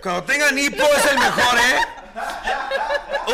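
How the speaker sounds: fuerte, agudo y riendo. Real, not acted. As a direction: dilo muerta de risa. Recomienda riendo. (riendo a carcajadas)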